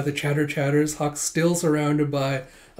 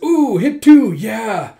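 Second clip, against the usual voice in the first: much higher and louder, a whooping cheer; excited cheer; 8 semitones higher, 11 dB louder, much slower than usual. Acted. A spectator cheering the archer's shot, loud high whoop.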